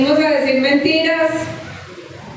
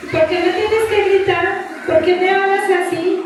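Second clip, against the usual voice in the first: higher-pitched imitation of her children; children's complaint; 6 semitones higher than usual. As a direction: protest like a child to a parent. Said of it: "usó a mis hijos para decirme".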